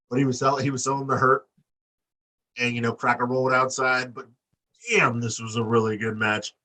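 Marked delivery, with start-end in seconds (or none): none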